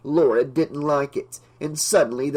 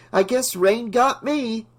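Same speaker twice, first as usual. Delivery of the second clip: bitter chuckle; 8 semitones higher than usual. A narrator's reading. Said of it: "she chuckled bitterly".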